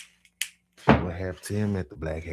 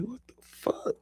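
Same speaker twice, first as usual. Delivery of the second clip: much higher, squeaked; startled disbelief; shocked. Retold or imitated. Seeing four Mustangs pull up, shocked squeak. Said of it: "I'm like".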